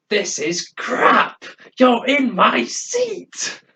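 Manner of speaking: high, slow, hammed-up yell of a grumpy old man; mock angry old man. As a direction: ham up a cranky old man shouting about his seat. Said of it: "he just shouting and everything, getting angry".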